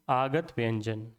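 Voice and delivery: slow, low, clearly said आगत व्यंजन; teacher's model answer. Shown as a demonstration. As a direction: spell out आगत व्यंजन slowly for the class. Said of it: "क्या बोलेंगे?".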